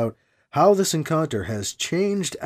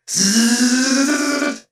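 higher sustained vocal buzzing; laser cutter buzz; 7 semitones higher than usual. A narrator's reading. Narrator as the laser burns through suit, imitated buzz. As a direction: imitate a cutter with a long zzz.

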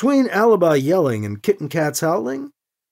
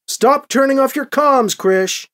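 firm order, pitched up; commanding; 7 semitones higher, 4 dB louder, slower than usual. Acted. Emily scolding Krish over the comm, commanding. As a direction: give it as a brisk command. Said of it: "Emily commanded".

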